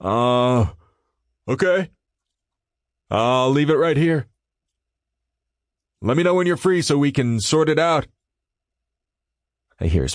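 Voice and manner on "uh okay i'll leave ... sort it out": higher, hesitant coworker voice; Tyler voice. A narrator's reading. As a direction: answer a bit unsure. Tyler answers through door.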